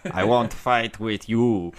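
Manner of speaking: loud mock Russian accent; hammed-up Russian fighter quote. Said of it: "pulled out the Russian accent".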